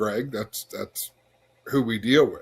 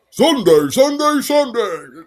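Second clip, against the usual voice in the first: booming announcer, rising pitch; monster truck ad; 10 semitones higher, 8 dB louder, slower than usual. Shown as a demonstration. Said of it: "monster truck voice has been happening for decades".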